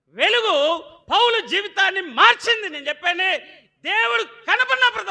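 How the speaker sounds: high, loud shouted sermon; full-force pulpit shout; fiery preaching. Acted. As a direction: drive it home to the church.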